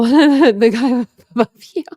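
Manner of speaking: loud laugh through the words; cracking up at a comment; laughing out loud, high. Real, not acted.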